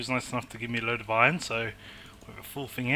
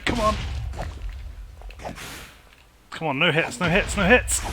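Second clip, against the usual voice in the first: will it over the line, tense; tense urging; 4 semitones higher than usual. Real, not acted. Boss nearly dead, urging.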